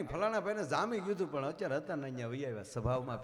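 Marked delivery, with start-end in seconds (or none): none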